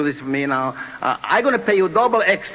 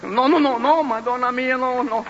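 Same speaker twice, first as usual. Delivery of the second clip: pleading; 5 semitones higher than usual. Acted. Tony pleading with Joe.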